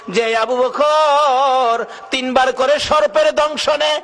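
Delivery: impassioned preaching. Acted. Abu Bakr pledging his life to the Prophet, impassioned preaching. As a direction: preach it as a high, rising pledge.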